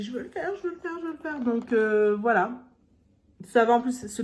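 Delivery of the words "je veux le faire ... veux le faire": put-on high, eager, childlike pleading. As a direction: beg for it like an excited kid. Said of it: "j'ai dit".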